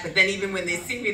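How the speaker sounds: ordinary talk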